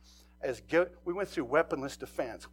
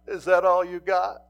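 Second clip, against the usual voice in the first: taunting; 4 semitones higher, 11 dB louder than usual. Retold or imitated.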